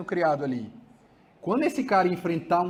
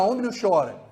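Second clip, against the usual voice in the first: louder, harsher scolding; father forbidding his son to cry; repressive father; 4 semitones higher, 6 dB louder, faster than usual. Shown as a demonstration. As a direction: bark the order at the child. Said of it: "Fala assim".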